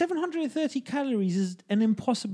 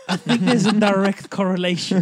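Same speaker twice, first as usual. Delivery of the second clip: louder, laughing through the words; 7 dB louder than usual. Real, not acted. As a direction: deliver the punchline through a laugh. Joking about hitting children, laughing.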